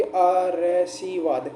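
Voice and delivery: आरसीवाद slowly, with र voiced before सी; sounding out spelling. Shown as a demonstration. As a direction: spell by ear, आ-र-सी-वाद in separate pieces. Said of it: "इसका उच्चारण करके देखिए".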